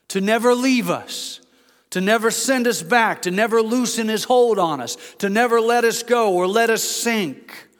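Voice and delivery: impassioned preaching. Acted. Preacher urging the congregation God never lets go, impassioned. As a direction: drive each never home in a rising list.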